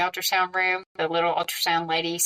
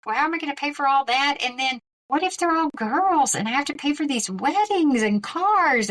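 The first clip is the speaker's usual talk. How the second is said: higher, racing worried rant; spiralling panic; 6 semitones higher than usual. Retold or imitated. On the phone to mom, spiralling into higher panic. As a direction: pile up the worries faster and faster. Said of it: "I should calm down".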